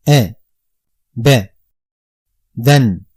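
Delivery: "a", "b", "den" each said alone as separate Arabic syllables. Shown as a demonstration. Teacher spelling a Quranic word for pupils, syllable by syllable. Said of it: "I say".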